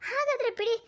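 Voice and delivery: high-pitched, anguished giving up; distraught despair. Acted. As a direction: voice rises in desperate grief. Mother despairs at police news.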